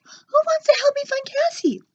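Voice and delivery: rally the mice with puppy eagerness; high eager dog voice. A narrator's reading.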